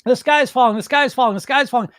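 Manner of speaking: hammed-up shrieking alarmist; Chicken Little. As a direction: cry disaster over and over in mock panic. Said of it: "It's Chicken Little".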